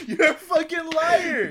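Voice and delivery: high, loud laughing outburst; laughing shout. Real, not acted. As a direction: yell it through laughter. Laughing accusation at phone caller.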